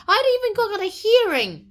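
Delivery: high, loud mock outrage; indignant protest. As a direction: shout a wronged prisoner's complaint. Dantes protests no hearing.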